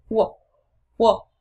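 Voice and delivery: "Uộc" cut extremely short; very short vowel. Shown as a demonstration. Teacher showing learners the short English U, "Uộc" kept very short. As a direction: say "Uộc" as a tiny quick vowel. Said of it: "nhưng mà vô cùng ngắn".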